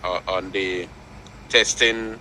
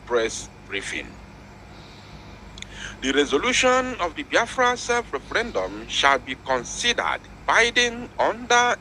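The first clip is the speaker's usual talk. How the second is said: high, urgent news read; breaking news; 7 semitones higher than usual. Retold or imitated. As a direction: announce it like a headline. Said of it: "He said".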